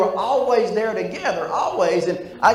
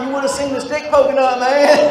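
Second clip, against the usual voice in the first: incredulous laughing shout; 8 semitones higher, 6 dB louder than usual. Retold or imitated. Incredulous punchline.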